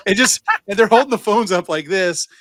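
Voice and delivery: usual speaking voice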